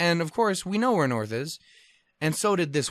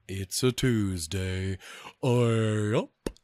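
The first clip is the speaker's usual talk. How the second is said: slow, lower, lazy fish voice; bored fish voice; 3 semitones lower, much slower than usual. Pretend. Narrator voicing lazy fish as a slow, lower drone. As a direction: voice a school of fish with nothing to do. Fish sitting there going like.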